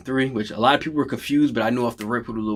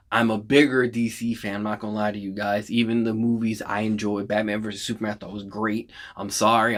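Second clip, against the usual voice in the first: ordinary talk, nothing marked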